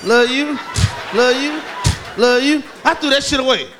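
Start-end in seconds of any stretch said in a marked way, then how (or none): 0.0-2.6 hammed-up skipping vinyl; skipping record. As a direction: mimic a scratched record repeating. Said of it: "That's when the scratch come in".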